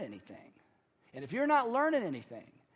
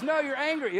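higher, louder retold rebuttal; emphatic contradiction; 6 semitones higher, 6 dB louder than usual. Counselor contradicting his frustrated client, emphatic retold rebuttal. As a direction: shoot back with a raised voice.

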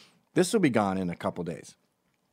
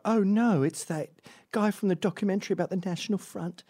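higher, alarmed posh gasp; mock posh alarm; 11 semitones higher than usual. Rich Brits in London recognise him, mock posh alarm. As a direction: play the startled rich Brit.

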